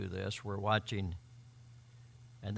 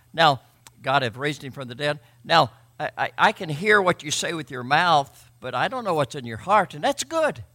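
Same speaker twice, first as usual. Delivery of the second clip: louder, higher preaching voice; full-force preaching; 3 semitones higher, 8 dB louder than usual. Acted. Pastor drives the gospel point home to his congregation, preaching hard. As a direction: proclaim it to the whole room.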